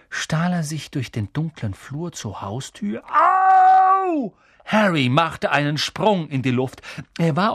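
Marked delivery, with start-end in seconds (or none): 3.0-4.3 high, loud cry of pain; startled yelp. A narrator's reading. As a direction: yelp in surprise. Harry steps on something.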